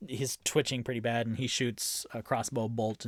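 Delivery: normal talking voice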